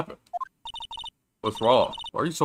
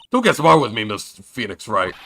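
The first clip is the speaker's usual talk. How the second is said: stern prosecutor voice; 8 dB louder than usual. Acted. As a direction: scold him like a proud rival prosecutor. Reading game character's scolding line.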